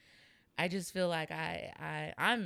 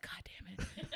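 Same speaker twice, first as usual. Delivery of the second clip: retold embarrassed whisper; whispered exasperation; 3 semitones higher, 10 dB quieter than usual. Student laughed at by classmates, cursing in a whisper. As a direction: curse whispered under the breath, half laughing.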